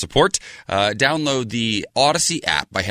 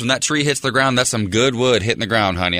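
ordinary talk

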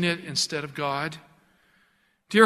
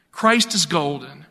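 ordinary delivery

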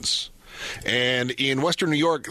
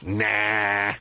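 imitating dad; 4 semitones lower than usual. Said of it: "He goes".

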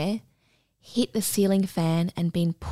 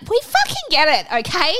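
high, loud, fed-up retort; retold exasperation; 10 semitones higher, 4 dB louder than usual. Embarrassed owner to the shocked woman, exasperated. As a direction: cut off someone's fuss with mock indignation.

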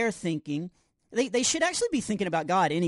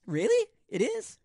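surprised; 11 semitones higher than usual. Real, not acted. Surprised his advice is praised.

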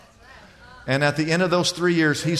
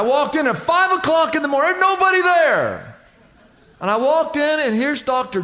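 rising excitement; 11 semitones higher, 4 dB louder than usual. Real, not acted. Preacher building the story.

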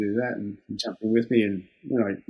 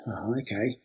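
much softer, sheepish okay; meek giving in; 8 dB quieter than usual. Retold or imitated. Young worker caving to trades assistant's demand, soft sheepish reply. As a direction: back down quietly, defeated.

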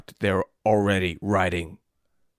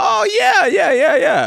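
squeaky yeahs that climb much higher and louder, then settle; over-the-top yeah; 9 dB louder, slower than usual. Acted. Matt Damon answers the beer invite, gushing, sky-high and loud. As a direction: gush yes way too eagerly.

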